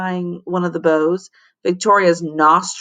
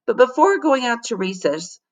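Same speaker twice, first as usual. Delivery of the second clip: ordinary delivery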